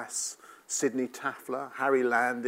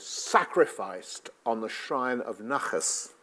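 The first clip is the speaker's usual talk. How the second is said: slow, deliberate sacrificed on the shrine of Nachas, with a falling close; father's phrase delivered slowly with weight; quoting his phrase; 3 dB quieter, slower than usual. Retold or imitated. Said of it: "and this was his phrase".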